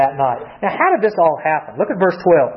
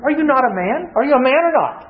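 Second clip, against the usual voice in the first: higher, goading challenge; mocking taunt; 7 semitones higher than usual. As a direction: needle him about his manhood. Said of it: "Men don't like to hear that question".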